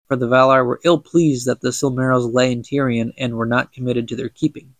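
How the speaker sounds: usual speaking voice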